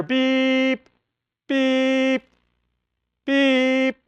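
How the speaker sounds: high, slow electronic beeps; radio beeps. Retold or imitated. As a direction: mimic an emergency radio alarm beep by beep. Imitating emergency radio alert.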